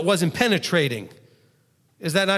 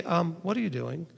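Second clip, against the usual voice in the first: soft mild question; 2 semitones lower, 8 dB quieter than usual. Retold or imitated. Walking over to a coworker and asking softly. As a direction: ask it gently and lightly. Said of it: "you just go over and say".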